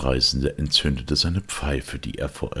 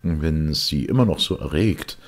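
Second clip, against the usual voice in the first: ordinary delivery, nothing marked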